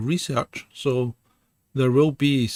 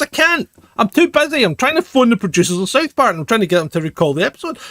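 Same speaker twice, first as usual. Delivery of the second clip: mock whiny Henry; 8 semitones higher, 4 dB louder, faster than usual. Quoting Henry's excuse.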